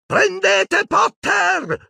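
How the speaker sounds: very high, drawn-out screeched order; shriek. A narrator's reading. Bellatrix orders Death Eaters after Harry, shrieking. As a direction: shriek the command, stretching each word. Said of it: "strillò Bellatrix".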